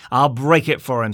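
loud angry outburst; loud furious threat; passionate. A narrator's reading. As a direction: blurt it out in sudden fury. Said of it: "flying into a passion again".

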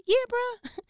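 high, loud, breezy yeah bruh; casual officer. Acted. The officer answering Jesus, high and breezy. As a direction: toss it off with swagger.

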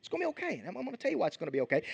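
Jesus gently calming his worried followers; comforting. Retold or imitated. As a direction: soothe frightened friends gently. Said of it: "Jesus comforting his followers".

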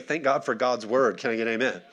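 usual speaking voice

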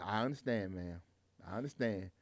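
very soft, low reassurance; hushed, calm retold reply; gently understanding. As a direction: let her off easy. Driver soothing the apologizing passenger.

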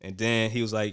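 usual speaking voice

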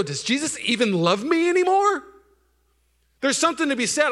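high, loud sermon voice; preaching at full force. Acted. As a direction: preach it hard to the room.

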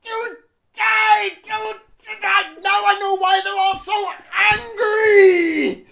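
high, loud comic yell; hammed-up angry shout; mock shout. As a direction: bellow it in mock fury.

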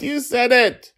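very high, loud accusation; child crowing in triumph; delighted, squealed gotcha; gleeful little girl. A narrator's reading. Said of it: "She laughed".

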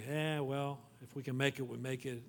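soft, low, shrugging dismissal; mock apathetic mumble, fading away; indifferent attitude. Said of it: "Our attitude towards the Lord's Day".

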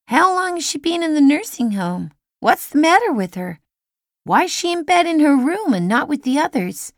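put-on high, loud curious child; boy quizzing his mother, high and loud; eager boy. A narrator's reading. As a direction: fire questions like an eager kid.

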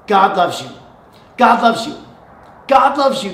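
building emphasis. Acted. Preacher to congregation, building to a loud proclamation. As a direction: build it up, stronger each time.

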